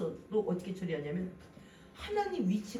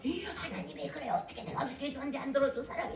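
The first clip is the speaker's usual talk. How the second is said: higher, quick whining voice; whiny complainer; 5 semitones higher than usual. Retold or imitated. Preacher mimicking a believer complaining to God, high and whiny. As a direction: whine like a disappointed believer.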